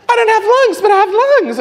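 very high, loud squeal of confusion; bewildered mock outcry. Convert describing the breath inside him, high amazed outcry.

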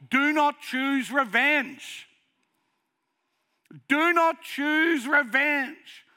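high, loud, slow pleading; pleading cry. Retold or imitated. Preacher pleading with people not to choose revenge, loud. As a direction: beg them not to take revenge.

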